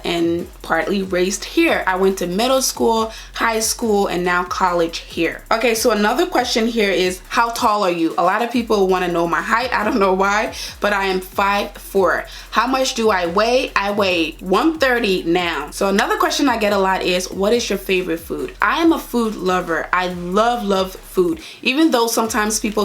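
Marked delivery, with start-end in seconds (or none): none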